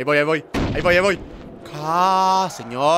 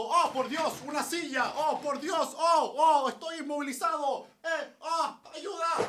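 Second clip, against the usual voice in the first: screaming while falling; 8 semitones higher, 10 dB quieter, slower than usual. Retold or imitated. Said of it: "me caigo como imbécil".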